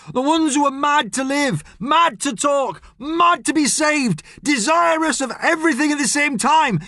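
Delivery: very high, ringing recital; exuberant declaiming. Acted. Friend reciting while flapping to take flight, wildly declaiming. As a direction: declaim it wildly, stretching each phrase.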